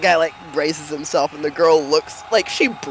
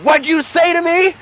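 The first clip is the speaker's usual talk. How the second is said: sharp, much higher threat in a rough put-on voice; menacing brawler; 11 semitones higher, 3 dB louder than usual. Brawling man to the older woman he grabbed, angry. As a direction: challenge her like you're about to hit her. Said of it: "grabs her by the hair and pulls her backwards and says".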